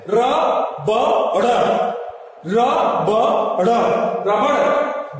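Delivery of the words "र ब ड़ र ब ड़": र, ब, ड़ spelled out one sound at a time; syllable by syllable. Shown as a demonstration. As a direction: break रबड़ into separate sounds with pauses. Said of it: "यहां पे देखिए सबसे best". (अक्षर-अक्षर अलग करके)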